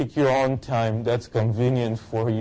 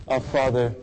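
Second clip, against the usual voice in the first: raised, pleading prayer voice; fervent prayer; 6 semitones higher than usual. Real, not acted. Pastor leading the congregation in prayer, fervent.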